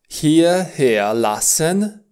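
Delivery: hierher lassen drawn out slowly; slow teaching model. Shown as a demonstration. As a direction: stretch hierher lassen for the class. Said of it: "se pronunță".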